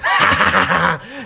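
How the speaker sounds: put-on drunken jeering laughter; mocking laugh. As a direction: laugh hard at a failure. Alcohol side laughs at him.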